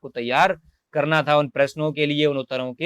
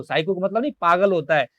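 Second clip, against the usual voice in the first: usual speaking voice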